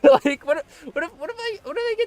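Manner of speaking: high, loud, panicky squeak; mock panic. Streamer dreading a bad roll, high mock-panicked squeak. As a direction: spiral into hammed-up fear. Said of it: "I'm so scared".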